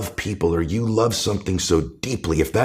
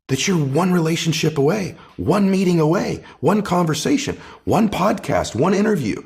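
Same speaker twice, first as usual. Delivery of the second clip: rising urgency; 8 semitones higher than usual. Real, not acted. Guest building his list to Jay, rising and urgent. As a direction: pitch climbs higher with each one, slowed and stretched.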